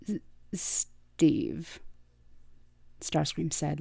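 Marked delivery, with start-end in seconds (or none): 0.1-1.8 soft breathy stammer on the name; shy stammered whisper. A narrator's reading. Shy robot whispering his name to Starscream. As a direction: whisper your own name, tripping on it. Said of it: "the Viacon supplied".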